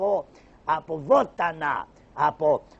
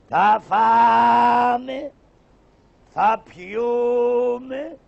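drawn-out call; 5 semitones higher, 8 dB louder, much slower than usual. Acted. Karagiozis opening patter to audience.

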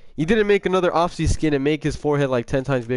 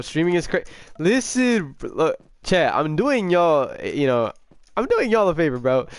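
ordinary talk

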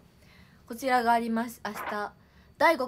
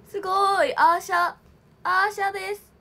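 higher, much louder gushing; thrilled exclamations; excited; 6 semitones higher, 8 dB louder than usual. Real, not acted. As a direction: gush over the panel. Admiring the Asha panel.

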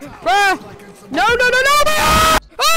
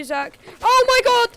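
ordinary delivery

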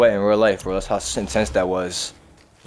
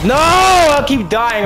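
high, loud, slow drawn-out groan of frustration; frustrated yell; 7 dB louder, much slower than usual. Real, not acted. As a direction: wail it out, fed up. Frustrated, keeps dying in game.

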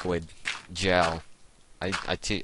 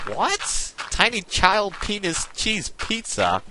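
much higher, slow, incredulous stranger voice; shocked disbelief; 12 semitones higher, slower than usual. Retold or imitated. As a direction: squeak it out like you can't believe your ears. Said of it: "he's like".

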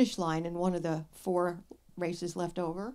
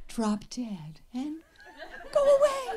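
whisper to shout; 8 semitones higher, slower than usual. Real, not acted. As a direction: start whispered, end in a high yell. Repeats joke, whisper then shout.

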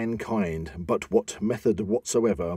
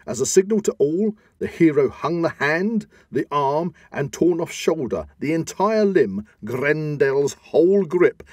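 higher all through, piling up each item; triumphant build; 6 semitones higher than usual. A narrator's reading. Narrator proclaiming the hero's trophy, triumphant and high. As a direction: proclaim the trophy with rising pride. Hero hangs Grendel's arm triumphantly.

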